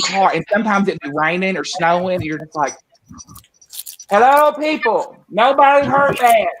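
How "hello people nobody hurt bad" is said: fed up with every car asking; high, louder mock exasperation; exasperated. Exasperated reporter to drivers.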